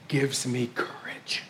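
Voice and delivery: breathy whisper of gratitude. Real, not acted. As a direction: whisper it to the faces in front of you. Tender moment with the congregation.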